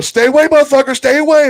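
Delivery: high, loud panicked yell; screaming at a possum to keep away, high and loud. Retold or imitated. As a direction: yell at the possum to stay back. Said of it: "I was yelling at him".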